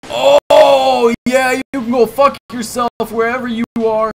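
high, loud angry yelling at the enemy; furious shout. Real, not acted. Gamer cursing the doctor who found them, furious shout. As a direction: yell defiance at the thing hunting you.

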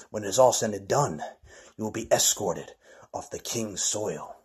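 low, soft, menacing promise; low threat. Acted. Wrestler quietly threatening his rival, low and soft.

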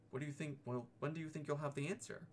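a nervous little piglet asking Pooh; timid little Piglet. Acted.

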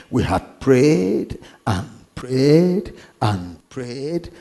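ordinary talk

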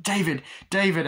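high-pitched mock alarmed call; acted worried shout; calling after him. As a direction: imitate a girlfriend calling after her boyfriend. Girlfriend calls after fleeing David.

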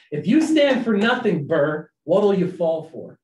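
loud mock Hamilton line; Hamilton quoting. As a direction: throw down the challenge, slowing at the end. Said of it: "he asks this question".

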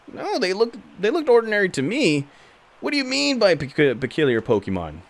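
lightly voiced curious character. Character dialogue read aloud.

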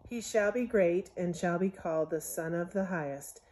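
ordinary delivery

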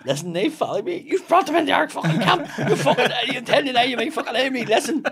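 loud, high-pitched, heated rant; acted angry tirade. Posing as a traveller to a stranger, loud angry bluster. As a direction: come in shouting as if you own the place.

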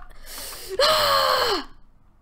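loud, high scream dropping at the end. Real, not acted. Player begging not to die, high scream. Panicking in the game.

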